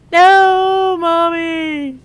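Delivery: put-on high, loud childish whine; whining child. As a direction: wail it like a child refusing. Child protesting, retold.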